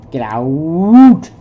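loud shout. Acted. Character ordering an intruder out, loud shout. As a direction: bellow it out, full force. Voicing a game character's command.

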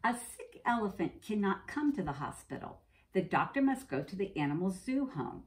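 ordinary talk, nothing marked

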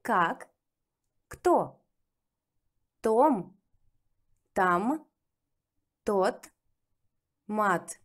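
teacher reading drill words; careful Russian reading. Shown as a demonstration. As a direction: read each word cleanly for the learner. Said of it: "let's check your results from the first lesson".

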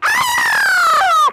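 long, very high shriek; rabbit scream. A narrator's reading. Storyteller as a hundred fleeing rabbits, high scream. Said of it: "a hundred rabbits running and screaming".

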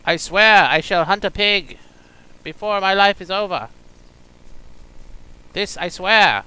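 high, loud hammed-up vow; mock heroic oath. Gamer swearing a grand oath to hunt a pig. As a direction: declaim it like a knight swearing an oath.

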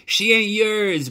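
slow, very high, loud scream; a boy screaming at his father over his sister. Acted. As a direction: scream it with everything. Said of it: "Bryce screamed".

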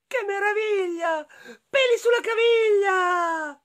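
high-pitched rhyming character voice, chanted refrains; freed enchanted prisoner, jubilant. Acted. The freed prisoner proposing marriage to Lucilla, high chanting voice.

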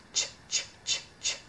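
Ch as short voiceless bursts; letter sound. Shown as a demonstration. Teacher sounding out cheese, ch in separate voiceless bursts. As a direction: repeat ch as a bare sound, no vowel.

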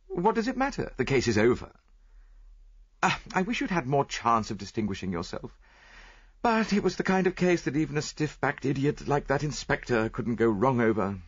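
impatient friend. A narrator's reading.

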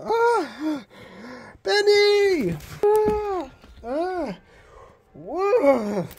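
high, frightened yelps; scared. Acted. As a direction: cry out in panic. Boris frightened by the ghost.

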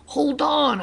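high-pitched urgent holler; storyteller's high crowd call. A narrator's reading. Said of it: "hollered the crowd".

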